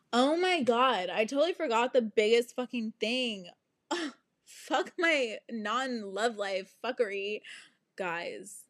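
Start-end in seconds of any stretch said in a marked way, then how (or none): none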